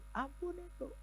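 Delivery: very high, urgent plea; urgent pleading. Retold or imitated. Pastor urging the woman to forgive, very high. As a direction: press her to forgive.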